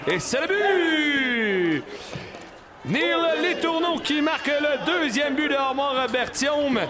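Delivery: excited sports commentary. Real, not acted. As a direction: shout the goal. Goal call in live commentary.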